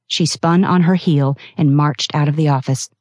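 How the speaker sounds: ordinary delivery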